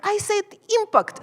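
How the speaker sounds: impact said with a sharp rise; stress demo. Retold or imitated. Herself correcting students on impact. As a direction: say impact pointedly. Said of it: "And just like".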